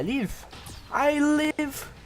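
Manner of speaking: high, loud and slowed disbelief; astonished delight. Real, not acted. Gamer amazed he survived the fight, astonished delight. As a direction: marvel that you made it.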